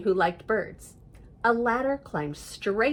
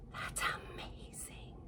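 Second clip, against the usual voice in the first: whisper; 18 dB quieter than usual. Real, not acted. Marvels softly at the picture.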